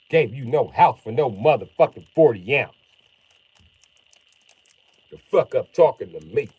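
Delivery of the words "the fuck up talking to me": normal talking voice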